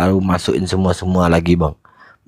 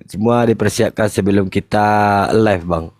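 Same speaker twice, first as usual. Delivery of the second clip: ordinary talk, nothing marked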